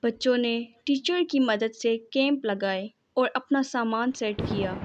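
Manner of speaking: ordinary delivery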